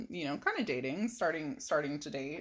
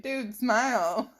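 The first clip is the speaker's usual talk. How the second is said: slow, louder call of Dude, smile; mock scolding call; 6 semitones higher, 7 dB louder, slower than usual. Friend teasing a date to smile, loud slow call. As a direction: tease him to smile, drawn out.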